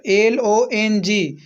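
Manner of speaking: letters spelled one by one, slowly; slow spelling. Shown as a demonstration. Teacher spelling a word for students, slowly. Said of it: "spelling the word aloud".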